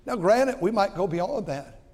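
ordinary delivery

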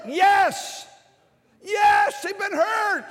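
high, loud preaching cry; emphatic shout. Real, not acted. Preacher conceding that teenagers have been hurt, shouted emphatically. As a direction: cry it out forcefully to the congregation.

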